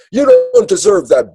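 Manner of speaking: a high, sharp parental put-down; scolding parent. Retold or imitated. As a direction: scold the child from above. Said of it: "the parent says".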